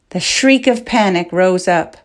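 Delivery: ordinary delivery, nothing marked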